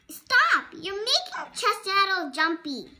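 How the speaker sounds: put-on a bossy child shouting at a friend; yelling stop. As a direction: bark the order to stop. Belle yells at Maddie.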